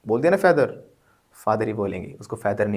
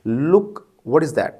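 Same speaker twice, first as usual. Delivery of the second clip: slow, louder call to look; pointing look; 2 semitones higher, 6 dB louder, much slower than usual. Shown as a demonstration. Teacher points students to a spot, drawn out and louder. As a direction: drag it out as if pointing at something. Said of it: "तो एक particular चीज़ को दिखाना".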